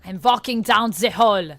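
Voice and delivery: loud mock German accent, every word barked; shouted German accent. Shown as a demonstration. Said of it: "Should I just shout everything?".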